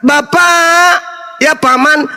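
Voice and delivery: high, laughing mock prayer voice. Preacher mocks a prayer asking for food, high and laughing. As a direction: lampoon a prayer with a giggle. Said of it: "maka saya akan berkata".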